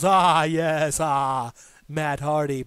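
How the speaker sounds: a lightly done, admittedly bad impression of Broken Matt's odd accent. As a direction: try the accent, knowing you botch it. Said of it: "I'm probably the worst at this accent".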